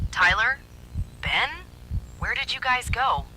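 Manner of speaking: high, bright mimic call; eerie calling. Fake Zoe calling from the main room, eerie. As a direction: call out like a lost friend, but wrong.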